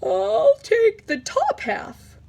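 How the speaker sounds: high, loud and drawn out; slow bear voice. Acted. Bear chooses the top half, slow and drowsy. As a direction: stretch each word like a drowsy bear.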